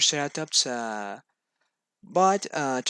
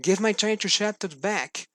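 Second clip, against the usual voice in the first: higher, pleading cry for his toy; cried out; 6 semitones higher, 3 dB louder than usual. A narrator's reading. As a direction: beg loudly to get it back. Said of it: "cried Juan Pablo".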